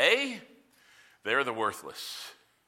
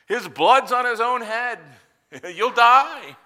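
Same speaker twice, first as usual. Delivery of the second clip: loud, high, dramatic proclamation with a laugh; booming warning; 10 semitones higher, 12 dB louder than usual. Retold or imitated. Preacher voicing the temple inscription's threat to Gentiles, loud mock menace.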